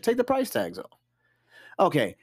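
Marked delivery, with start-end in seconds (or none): 0.0-1.0 pleading exasperation; exasperated high plea. Real, not acted. Host begging cheap givers, high and exasperated. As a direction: take the price tags off, much higher in an odd voice. Repeating advice emphatically.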